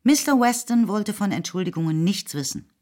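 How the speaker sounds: ordinary talk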